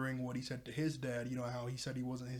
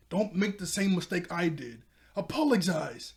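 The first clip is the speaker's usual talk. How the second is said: louder, higher retold urging, slowing on the last word; acted stern demand; 4 semitones higher, 7 dB louder than usual. Ren urging Bond to apologize to his dad, acted demand. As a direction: demand it forcefully, as in a retelling.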